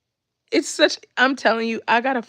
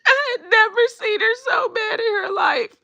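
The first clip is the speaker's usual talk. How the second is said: much higher and louder, laughing through words; helpless laughter, real; 5 dB louder than usual. Real, not acted. Telling viewers how mad the little girl was, laughing hard. Laughing retelling the girl's anger.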